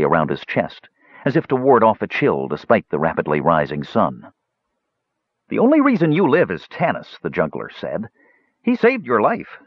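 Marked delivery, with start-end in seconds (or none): 5.5-9.6 high-pitched, insistent juggler; juggler character. Acted.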